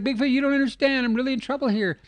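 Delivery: put-on squeaky scared boy begging for help; trapped boy pleading with Bigfoot, high and hurried; panicked kid. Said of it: "the kid's like".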